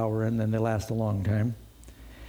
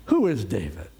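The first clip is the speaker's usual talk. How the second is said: mildly scornful retort; a touch of harsh contempt; harsh answer; 4 semitones lower than usual. Retold or imitated. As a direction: dismiss him with a pointed question. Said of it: "he gives a harsh answer".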